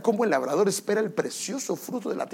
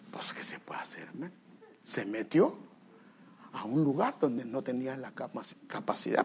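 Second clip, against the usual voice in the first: ordinary talk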